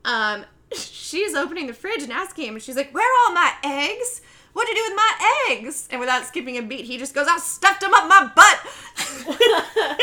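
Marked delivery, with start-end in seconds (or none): 2.9-5.9 high, loud mock outrage; mom demanding. Mom demands eggs from son. 7.3-10.0 loud, high, drawn-out comeback; mock defiant retort. Rude son's retort, retold.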